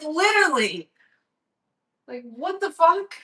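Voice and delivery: high-pitched, indignant outburst; retold exasperation. Vlogger still retelling rant at friend, exasperated. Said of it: "I was actually mad".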